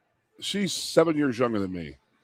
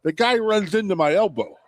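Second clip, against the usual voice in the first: put-on Ed Van Heppen's Western Canadian accent; 8 semitones higher than usual. Flyers announcer shrugging off his elbow to the camera, Western Canadian accent. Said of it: "he had that Western Canadian accent. He talked like this".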